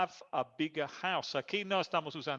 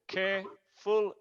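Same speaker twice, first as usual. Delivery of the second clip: "Careful" broken in two, care - ful; split into syllables; 4 semitones higher, slower than usual. Shown as a demonstration. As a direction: pause between the syllables of "Careful". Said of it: "es dos sílabas".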